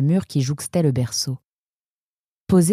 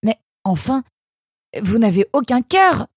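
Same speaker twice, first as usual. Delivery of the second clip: higher, louder reproach; indignant Nemrod; 8 semitones higher, 4 dB louder than usual. A narrator's reading. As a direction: accuse her of having no heart. Nemrod accuses the nurse.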